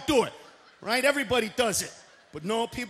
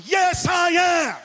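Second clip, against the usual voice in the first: ordinary talk, nothing marked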